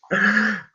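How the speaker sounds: a brief real laugh to yourself; amused chuckle. Real, not acted. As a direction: chuckle at your own remark. Amused at own lens remark.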